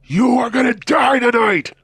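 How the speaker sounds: high, loud, drawn-out scream; screamed threat. A narrator's reading. Crazed driver to narrator at a red light, screaming. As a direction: scream it slowly at full force, meant to terrify. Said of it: "I mean, literally screams at me".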